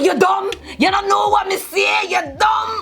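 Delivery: high shouted Jamaican patois; Jamaican patois accent. Retold or imitated. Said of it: "speaking patois".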